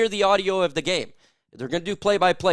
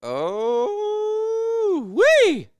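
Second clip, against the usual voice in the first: much higher, long strained cry into a whoop. Real, not acted. Streamer chugging a beer bong, crying out. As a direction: wail through the chug.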